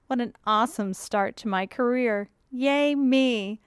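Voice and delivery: mock celebration; sarcastic. Blogger back at Mom and Dad's, sarcastic. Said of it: "Again, sarcasm".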